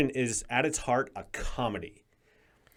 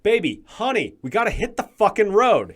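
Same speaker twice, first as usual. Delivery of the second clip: put-on higher, louder hurried yell at his girlfriend; mock-urgent shout; frantic yelling; 8 semitones higher, 8 dB louder than usual. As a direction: bark it out in a panic to get moving. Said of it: "he's like".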